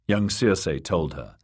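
ordinary delivery, nothing marked

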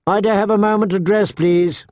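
put-on high, quick, polite girl's request; calling out. A narrator's reading. Sansa asks for time to dress, calling out. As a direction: call politely through the door, quickly. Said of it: "she called out".

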